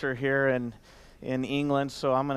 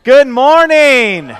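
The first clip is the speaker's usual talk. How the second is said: much louder and higher, drawn out slowly; a loud, stretched-out good morning to wake the crowd; big loud greeting; 10 semitones higher, 18 dB louder, much slower than usual. Shown as a demonstration. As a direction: call it out slow to the whole room like you mean it. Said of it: "No, no, no, no, no".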